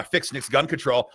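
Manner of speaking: usual speaking voice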